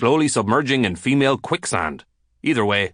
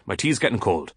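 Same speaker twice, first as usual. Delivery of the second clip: plain reading voice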